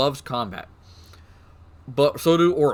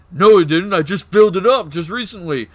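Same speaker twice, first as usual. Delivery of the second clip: a louder, higher big-orc bluster; 4 semitones higher, 6 dB louder than usual. Acted. Big orc insisting to the crew, loud and higher. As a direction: bellow it like the boss orc. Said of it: "the big orc goes".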